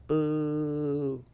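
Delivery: barely-there heckle from the back; light boo. Retold or imitated. Guy in the back heckling a bombing comic, light boo. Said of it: "Just light".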